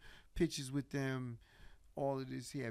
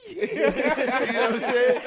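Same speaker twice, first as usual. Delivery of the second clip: slow, loud laughing question; cracking up mid-sentence; 9 semitones higher, 19 dB louder, slower than usual. Real, not acted. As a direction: laugh through it.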